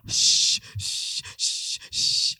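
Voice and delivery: put-on breathy shushing hiss to calm a baby; soothing shh sounds. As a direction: shush the crying baby, urgent. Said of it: "I was just".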